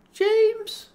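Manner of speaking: high loud call of his name. A narrator's reading. Laura calls James from behind, high and loud. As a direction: call out sharply from the doorway.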